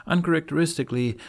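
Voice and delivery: plain reading voice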